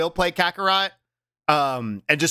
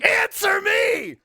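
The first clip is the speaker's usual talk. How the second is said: high, drawn-out imitation yell; imitated Matt yell; much slower than usual. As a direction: bellow it high, stretching each word. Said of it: "I just love Matt yelling".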